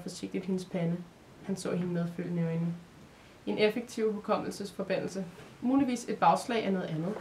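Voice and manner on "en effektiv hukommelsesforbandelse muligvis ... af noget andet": ordinary delivery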